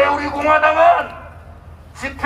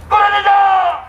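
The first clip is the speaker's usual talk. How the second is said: raised slogan shout; rally shout; 4 semitones higher than usual. Real, not acted. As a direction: lead the chant, full voice. (끌어내자 구호 외침)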